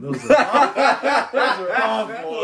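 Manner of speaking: high, loud words broken by laughter; laughing. Real, not acted. Brother recalling the rusty hook prank, laughing. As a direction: struggle to speak through real laughter. Cracking up at a memory.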